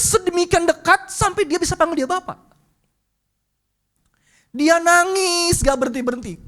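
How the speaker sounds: high, loud impassioned preaching; full-force preaching. Acted. Preacher tells the congregation of the boy's conversion, impassioned. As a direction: preach it with rising fervour, near tears.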